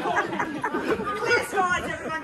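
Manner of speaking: loud, projected theatrical captain, rallying the crew; boisterous captain cheering on his crew; stage convict captain. Acted. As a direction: play it big for the back row, a jolly ship's captain. Actor playing convict captain on stage.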